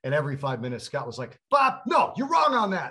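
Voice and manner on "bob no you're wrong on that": high, loud mock yell of disagreement; retelling a colleague screaming at Bob; imitated yelling. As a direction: shout him down like in the booth. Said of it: "And the screaming would start".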